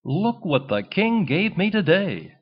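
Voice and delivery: a duckling showing his family his legs; excited. A narrator's reading. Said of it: "Buzzy cried".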